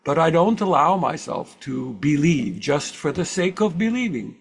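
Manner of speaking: imitating an old Swiss German speaker; Carl Jung Swiss accent. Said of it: "my best impression of Carl Jung with a Swiss accent".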